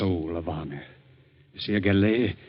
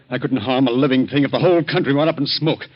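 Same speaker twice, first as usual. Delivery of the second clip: louder, outraged refusal; raised voice, refusing outright; indignant protest; 4 semitones higher, 6 dB louder than usual. Acted. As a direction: push back hard against the plotters. Scientist protests the murder plot.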